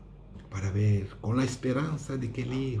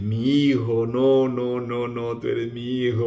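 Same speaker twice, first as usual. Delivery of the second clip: joyful father; 5 dB louder than usual. Acted. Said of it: "muy contento".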